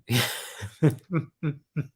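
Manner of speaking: high giggling laugh; light amused giggle. Real, not acted. Laughing at chat.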